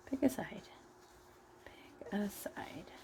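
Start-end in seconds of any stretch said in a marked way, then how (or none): 1.6-2.9 slow, soft whispered repeat; whispering. Real, not acted. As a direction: breathe it out slowly, whispered. Coaxing stuffing piece, repeated.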